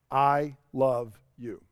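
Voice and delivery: low, slow, gentle "I love you"; tender sign-off. Retold or imitated. Family member ending a conversation, tender sign-off.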